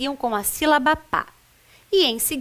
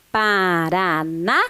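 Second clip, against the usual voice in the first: slow, lower "Paranaguá", syllables drawn out with guá set apart; leading a repetition; 5 semitones lower, slower than usual. Shown as a demonstration. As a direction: draw out Paranaguá so learners can join in. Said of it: "nós vamos repetir lá comigo". (puxando a repetição, sílabas esticadas)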